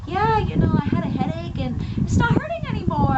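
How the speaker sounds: breezy offhand report, mildly mocked; lightly voiced calm believer shrugging it off; mock casual. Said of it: "they're all relaxed".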